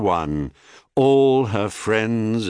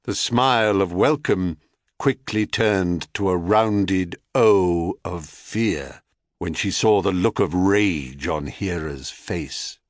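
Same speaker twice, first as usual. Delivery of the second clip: usual speaking voice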